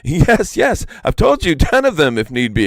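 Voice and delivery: high, loud, impatient yes; impatient exclaim. A narrator's reading. Iktomi impatiently promising the peacock, high loud exclaim. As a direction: snap it out, fed up with waiting. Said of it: "exclaimed Iktomi, with some impatience".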